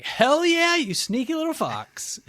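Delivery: high, loud hell yeah cheer; excited. Retold or imitated. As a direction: cheer it out, thrilled. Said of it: "I was super pumped".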